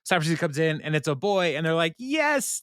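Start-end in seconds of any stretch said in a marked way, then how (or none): 2.0-2.6 much higher burst of "Yes!"; joyful cheer. Retold or imitated. Expecting parents cheer on hearing it's a boy. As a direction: squeal it out in delight.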